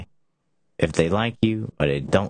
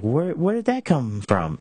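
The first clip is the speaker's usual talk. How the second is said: high, slow, baffled question; startled disbelief; 11 semitones higher than usual. Retold or imitated. Wondering aloud where someone's explosion came from, baffled. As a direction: ask it as if caught off guard by an outburst. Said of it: "And you're just like".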